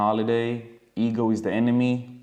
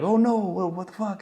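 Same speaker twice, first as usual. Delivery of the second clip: high-pitched shocked cry; 9 semitones higher than usual. Retold or imitated. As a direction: jump up in alarm at the news. Reaction to warehouse fire.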